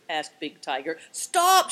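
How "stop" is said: high, loud shout; sudden high yell; panicked shout. A narrator's reading. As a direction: shout to halt them. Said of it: "shouted the man".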